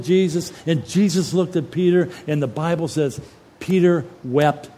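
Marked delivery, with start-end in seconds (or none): none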